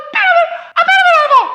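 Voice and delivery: cartoonish high-pitched squeak; squeaky high voice. Shown as a demonstration. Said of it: "In case that was totally unintelligible".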